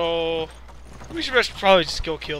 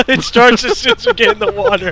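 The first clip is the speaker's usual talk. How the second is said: laughing; 13 semitones higher, 8 dB louder than usual. Real, not acted. Laughing through the chase.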